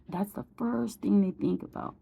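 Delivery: usual speaking voice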